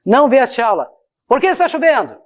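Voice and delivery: statement falling on "aula", then a high new question from "Por que"; wrongly split intonation. Shown as a demonstration. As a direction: close off "aula", then ask "Por que está chovendo" high and quick. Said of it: "Não é assim, ó".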